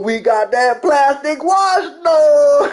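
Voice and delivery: drawn-out, high hammed-up holler; mock triumphant shout. Streamer parodying a showcase for his homie, mock holler. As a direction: stretch it out high like a gleeful parody punchline. Performs parody punchline, laughs after.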